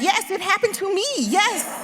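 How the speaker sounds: loud, high, laughing yes; excited insistence. Retold or imitated. Child insisting to her mother, loud and laughing. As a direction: insist eagerly, almost laughing. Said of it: "I was like".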